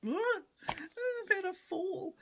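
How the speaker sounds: high, drawn-out mock sobbing wail. Acts out breaking down at counter.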